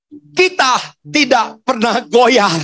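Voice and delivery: berkhotbah sekuat tenaga, keras dan tinggi; seruan khotbah lantang. Real, not acted. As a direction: serukan keyakinan kepada jemaat dengan penekanan lambat di awal. Puncak seruan khotbah kepada jemaat. (seruan iman yang lantang)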